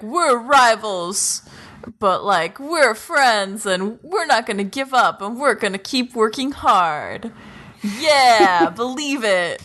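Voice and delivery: slow, hammed-up heroic declaration; mock don't-give-up speech. Mocking the speech all shonen have, hammed-up heroic resolve. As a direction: parody a rousing anime pep talk. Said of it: "don't give up bullshit speeches".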